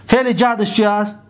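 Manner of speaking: ordinary talk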